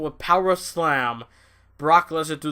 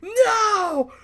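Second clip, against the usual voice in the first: much higher, wailing and half-laughing, slow; mock horrified Paul Heyman; 3 dB louder, much slower than usual. Imitating Heyman seeing Brock crushed, a high mock wail. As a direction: ham up a sobbing manager watching his guy get hurt. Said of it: "he was like".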